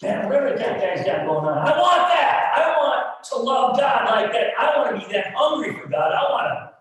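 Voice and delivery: put-on loud, eager boyhood longing. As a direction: cry out with hungry wanting. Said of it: "I'm like".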